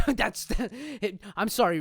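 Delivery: laughing. Real, not acted. Laughing through broken line.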